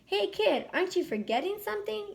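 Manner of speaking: put-on high taunting call to the kid; mocking yell. Player yelling at SpongeBob about the helmet, high and mocking. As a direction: yell it with a snort. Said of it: "yelled one of the other players with a snort".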